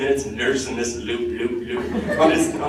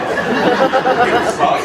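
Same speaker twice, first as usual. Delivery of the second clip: swaggering, louder and slower on the repeat; brash defiance; 8 semitones higher, 5 dB louder, slower than usual. Acted. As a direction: build into loud, drawn-out bravado. Emphatic bravado, repeated for laughs.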